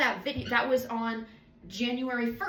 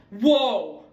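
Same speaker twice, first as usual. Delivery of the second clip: shouted startled whoa; loud whoa; 12 dB louder than usual. Real, not acted. Vlogger catches herself mid-thought, loud surprised whoa. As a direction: let it burst out loud. Sudden exclamation.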